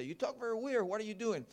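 mom's voice. Retold or imitated. Mother teasing her son about his odd Spanish, high mock voice. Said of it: "my mom used to say to me".

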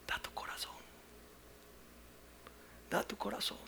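whispered then soft; hushed plea. Acted. Pastor tenderly telling the rich man to give his heart, hushed. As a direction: say it gently, almost a whisper.

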